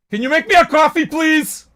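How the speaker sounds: high, loud call up to family upstairs; yelled request. Real, not acted. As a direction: shout up the stairs for a favor. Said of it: "That's why I yell up to my family".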